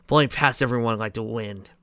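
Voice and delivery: plain reading voice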